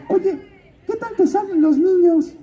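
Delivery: high-pitched godmother voice. Retold or imitated. Godmother asking the actor about his range, in a high put-on voice. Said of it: "y me dice".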